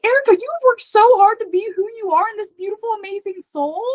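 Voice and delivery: high, loud cheerleading voice; pep talk to self. Retold or imitated. Talking to herself, a high hyped pep talk. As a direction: hype yourself up like a best friend. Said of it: "I just learned this".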